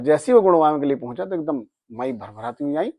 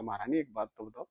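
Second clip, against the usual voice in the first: hushed reverent plea; 4 semitones lower, 14 dB quieter than usual. Retold or imitated. Devotee softly addresses the goddess.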